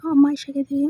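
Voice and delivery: usual speaking voice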